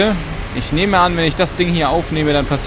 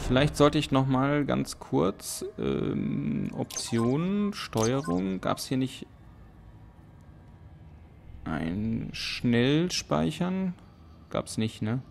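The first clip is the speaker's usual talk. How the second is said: quiet mumbling under the breath; muttering to self; 12 dB quieter, slower than usual. Real, not acted. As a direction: mumble half to yourself, distracted. Muttering while browsing menu options.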